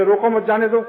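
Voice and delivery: روکو مت جانے دو run together in one breath; روکو مت joined without a stop; no pause. Shown as a demonstration. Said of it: "وقف نہ کیا معنی بدل گئے".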